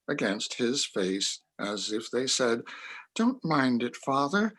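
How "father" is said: ordinary talk, nothing marked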